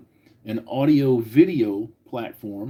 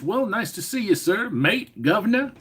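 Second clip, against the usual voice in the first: a hammed-up British accent pitched much higher; clumsy mock British accent; bad British accent; 9 semitones higher than usual. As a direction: greet him like a cartoon Brit, badly. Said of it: "I'm sorry, that was fucking embarrassing".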